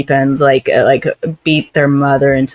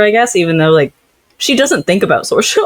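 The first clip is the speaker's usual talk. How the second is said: ordinary talk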